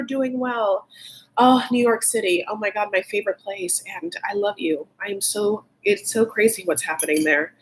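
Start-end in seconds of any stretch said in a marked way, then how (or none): none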